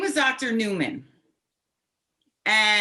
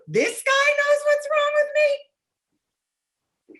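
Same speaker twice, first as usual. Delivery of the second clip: much higher and drawn out slowly; incredulous; 4 dB louder, much slower than usual. Retold or imitated. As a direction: stretch it out in pure disbelief. Disbelief at the student's hum.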